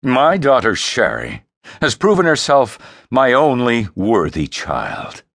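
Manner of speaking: proud, commanding father king; stern father. Acted. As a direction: announce it with cold paternal pride.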